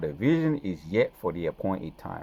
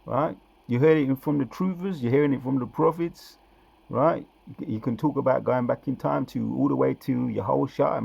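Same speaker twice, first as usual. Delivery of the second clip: high, loud preacher's call to the crowd; raised-voice sermon to the faithful; fiery preaching; 6 semitones higher, 5 dB louder than usual. Acted. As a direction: rouse the listeners like a street prophet. Preaching at full force.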